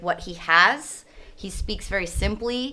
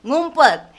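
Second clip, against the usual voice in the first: normal talking voice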